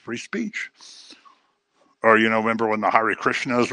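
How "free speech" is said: mock tolerance. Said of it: "Everybody goes".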